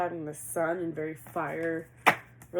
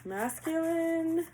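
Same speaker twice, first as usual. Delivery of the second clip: calling out; 9 semitones higher, 3 dB louder, much slower than usual. Acted. Calling out to the spirit.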